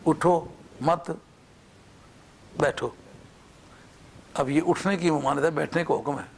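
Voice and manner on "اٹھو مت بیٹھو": بیٹھو set apart after a long pause following مت. Shown as a demonstration. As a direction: draw out اٹھو مت and break before بیٹھو. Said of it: "اب مت پر وقف کرو".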